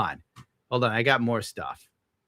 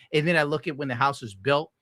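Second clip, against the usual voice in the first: normal talking voice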